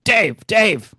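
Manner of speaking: parroting Roth's ghost voice; mock ghost call. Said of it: "it's like Sam Kinison".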